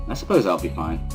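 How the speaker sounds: ordinary delivery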